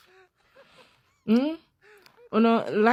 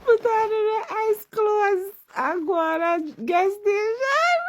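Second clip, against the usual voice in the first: higher and slow, hammed-up excitement; mock-excited high exclaim; 13 semitones higher than usual. Joking to viewers about wearing ice clothes, hammed-up high exclaim. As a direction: stretch it out like a gleeful plan.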